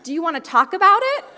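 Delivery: high, loud, laughing mock concern; amused disbelief. Mom answering her toddler's grown-up complaint, loud and laughing. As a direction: gasp it out through laughter. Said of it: "And I was like".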